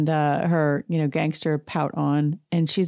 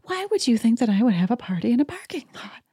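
higher, annoyed mock Kyle; irritated Kyle impression; 7 semitones higher than usual. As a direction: complain at him like Kyle. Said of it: "she's like".

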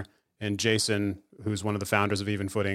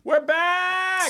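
high, sung-out 'We're back!' announcement; excited return call. Acted. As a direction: belt out 'We're back!' like a show jingle, much higher.

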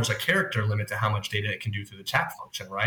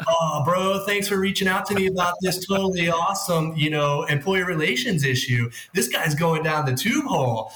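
surfer bro voice; 6 semitones higher than usual. Pretend. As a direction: ham up a chill beach bro. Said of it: "talk like a surfer bro".

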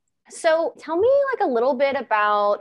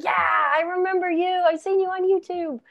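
high, bright-eyed recognition; higher excited self-quote; excited recall; 7 semitones higher than usual. Retold or imitated. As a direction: squeal it like spotting a fan favourite. Retelling her own excited thought.

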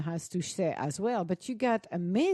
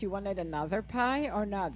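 ordinary talk, nothing marked

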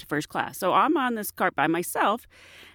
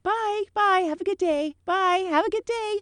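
singsong flight attendant goodbye; 9 semitones higher than usual. Retold or imitated. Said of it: "And I said".

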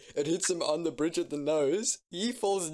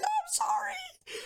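high incredulous; much slower than usual. Retold or imitated. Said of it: "And I was like".

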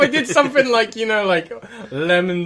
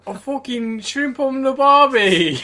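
put-on drawn-out, raised Aussie accent; Australian accent; 4 semitones higher, slower than usual. Player joining the accent game for laughs, Australian accent. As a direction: ham up a slow Australian. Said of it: "you sound more Australian than her".

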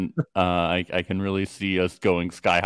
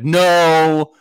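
mock loud protest; 6 semitones higher, 8 dB louder, much slower than usual. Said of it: "And they were like".

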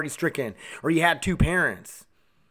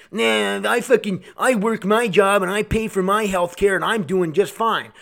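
put-on voice of a smug objector; mocking imitation; 3 semitones higher, slower than usual. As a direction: mimic a selfish person scornfully. Mocking selfish people's excuse.

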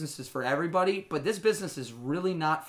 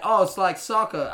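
mock Australian accent, louder and drawn out; imitated Australian accent; 4 semitones higher, 6 dB louder than usual. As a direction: lightly mimic an Australian accent, slow and raised. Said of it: "tried to do a little accent".

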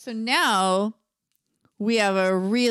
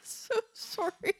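laughing mock sorry; 12 semitones higher, 8 dB quieter than usual.

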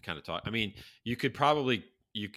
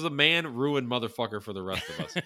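laughing while quoting the line; half-laughing movie quote; quoting through a laugh; 4 semitones higher, 5 dB louder than usual. Retold or imitated. Retelling the funniest line to his friend, laughing. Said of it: "He goes".

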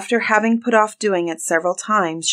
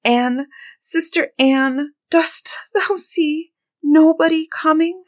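high, slow, drawn-out appeal; crying out; 10 semitones higher, slower than usual. A narrator's reading. Desperate wife calling to her sister once more, crying out. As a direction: stretch the desperate call in a high voice. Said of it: "and then she cried out".

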